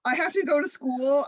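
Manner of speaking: put-on teenage protest; protesting excuse. Acting out excuse to parents.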